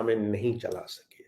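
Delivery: breathy emphatic whisper at the end of a thought. Acted. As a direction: drop to a whisper for effect. Dramatic drop while preaching.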